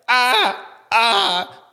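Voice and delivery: short comic cries of alarm; mock frightened cry. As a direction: yelp as if jumping back. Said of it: "the earth moves back like".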